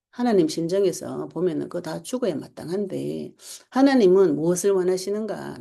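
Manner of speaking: usual speaking voice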